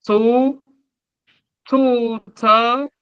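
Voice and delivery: Tout, tout, tub pitched high; rising tone. Shown as a demonstration. Teacher repeats the tone contrast. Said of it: "la différence au niveau de l'intonation".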